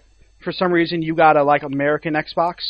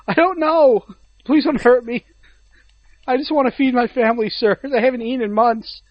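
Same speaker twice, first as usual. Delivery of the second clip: higher, cowering inventor voice; frightened pleading; 6 semitones higher than usual. Acted. Kinect's inventor answers those throwing them at him, pleading fearfully. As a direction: beg for mercy, mock-pitiful. Inventor begging his angry boss.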